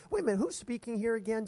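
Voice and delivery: normal talking voice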